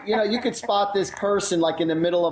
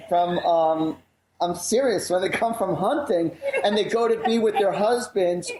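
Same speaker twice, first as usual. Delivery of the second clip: ordinary talk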